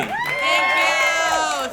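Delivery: bright, high, loud thanks to the crowd; excited thank you. Real, not acted. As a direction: greet the cheering crowd with a burst of energy. Cheering crowd greets her.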